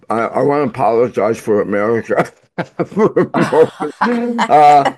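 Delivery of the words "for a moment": words broken by a high laugh. Real, not acted. Guest jokes about apologizing for America.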